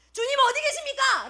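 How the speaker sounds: high, held-back cry to God. Acted. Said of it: "라고 외칩니다".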